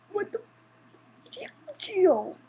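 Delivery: high, squeaky disbelief; imitated shocked what; mock shock. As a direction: act stunned at the weirdo. Shocked reaction to customer.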